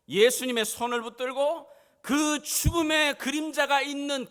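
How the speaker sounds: high, very loud impassioned proclamation; full-force preaching. Real, not acted. (높고 우렁차게 외치는 설교)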